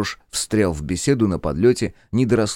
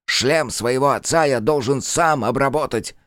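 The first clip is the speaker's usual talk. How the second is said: proud; 6 semitones higher, 3 dB louder than usual. A narrator's reading. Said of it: "гордо ответил дебил".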